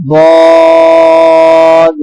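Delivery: باد said full and heavy, drawn out; heavy daad. Shown as a demonstration. Teacher models the heavy letter for the class. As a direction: give باد a thick, full sound. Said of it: "اسے بھی پر پڑھیں گے".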